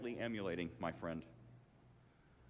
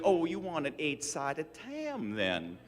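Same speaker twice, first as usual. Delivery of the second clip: put-on slow, drawn-out Southern accent; imitating the Southern clerk's matter-of-fact verdict; 3 semitones higher, much slower than usual. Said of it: "in her heavy Southern accent".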